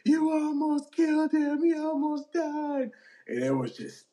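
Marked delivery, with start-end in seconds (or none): 0.0-2.9 high, slow, shrill mock panic; mock hysterical wail. Girlfriend still wailing at the bouncers, high mock hysteria. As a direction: whine it like the frantic girlfriend. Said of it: "the woman's still going".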